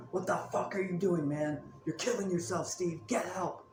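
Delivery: high-pitched mock yell, held back in volume; retold yell. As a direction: imitate the grandfather's exasperated yell without real loudness. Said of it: "the grandfather yelled at me".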